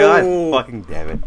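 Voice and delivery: angry swearing. Real, not acted. Frustrated after crash.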